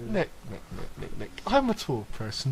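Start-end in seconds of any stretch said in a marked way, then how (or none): 0.1-1.3 softer and softer teasing; fading to a whisper. Acted. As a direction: let it die to a whisper.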